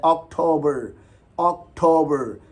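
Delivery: अक्टूबर as ok-TOH-ber; slow अक्टूबर with English "okt" start, ok-TOH-ber; English pronunciation. Shown as a demonstration. Teacher modelling for learners, अक्टूबर as English ok-TOH-ber. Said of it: "इसको English में ऑक्ट करके पढ़ना है".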